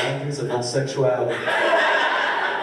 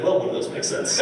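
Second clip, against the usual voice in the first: dry dad quip; 7 semitones higher than usual. Retold or imitated. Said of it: "he was like".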